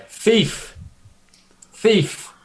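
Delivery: high cry of thief; calling thief. Acted. Hengist calling out to the crowd, high, thief. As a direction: call out to raise the alarm. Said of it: "you know, quite loud".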